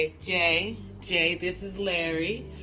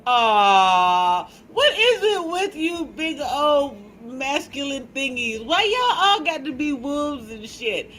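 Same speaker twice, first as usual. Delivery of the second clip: loud, higher-pitched playful grumble; mock exasperation; 5 semitones higher, 6 dB louder than usual. Host teasing viewers about masculine animals, mock-exasperated. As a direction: whine loudly in pretend dismay.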